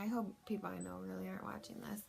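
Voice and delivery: a fast, hushed whisper; embarrassed whispered aside to the viewers. Real, not acted. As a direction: whisper it as a sheepish confession.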